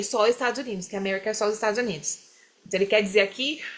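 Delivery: ordinary talk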